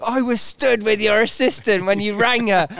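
high-pitched, indignant mock shout; retold angry shout. Trainer confronting a colleague about his excuse, mock shouting. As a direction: imitate an angry man catching out a lie. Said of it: "He starts shouting at me".

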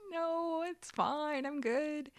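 high, soft brush-off; polite refusal. Retold or imitated. Wife retells turning down a bedtime story, polite refusal. Acting out declining bedtime stories.

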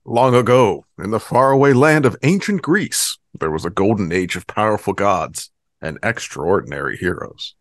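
grand storybook narration voice; epic fantasy narrator. Acted. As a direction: mythic trailer narration. Mock epic intro narration.

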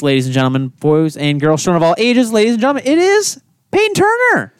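booming circus ringmaster intro. Acted. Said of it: "I got too into it".

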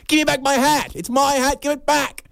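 very high indignant mock whine; whiny shoplifter. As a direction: demand the hat back in a high put-on voice. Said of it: "the lady was like".

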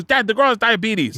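daughter voice. Retold or imitated. Daughter correcting dad.

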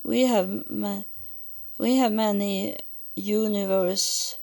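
usual speaking voice

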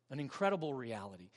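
normal talking voice

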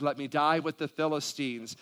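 usual speaking voice

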